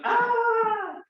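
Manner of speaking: high, loud yelp of a stepped-on foot; mock yelp of pain. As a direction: yelp like your toes just got crushed. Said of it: "And it goes".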